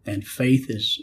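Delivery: usual speaking voice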